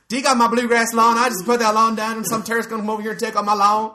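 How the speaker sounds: high, loud mock American homeowner; irate lawn owner. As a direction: protest loudly about your precious lawn. Acts out angry American lawn owner.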